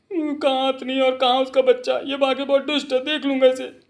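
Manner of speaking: very high, slowish odd little voice; high put-on character voice. Story figure vowing to deal with Bankelal, high put-on voice. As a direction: hold a thin thread of high pitch throughout.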